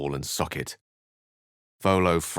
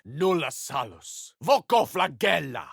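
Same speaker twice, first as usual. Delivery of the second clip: rising incantation; 8 semitones higher than usual. Acted. Latin spell cast in game.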